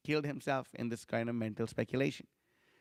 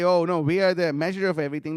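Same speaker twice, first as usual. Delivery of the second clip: mocking boast; 2 semitones higher, 8 dB louder than usual. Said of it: "But they say".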